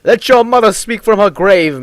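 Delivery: high, loud, hammed-up mock shout; joking yell. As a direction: bellow it for fun, like a mad mage. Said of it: "And Myth shouts / he yells jokingly".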